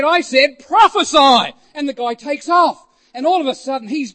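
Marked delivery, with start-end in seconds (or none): 0.0-1.5 loud, high retold command. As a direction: insist on it forcefully. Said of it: "And again he said".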